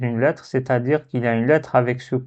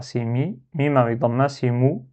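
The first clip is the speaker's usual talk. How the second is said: normal talking voice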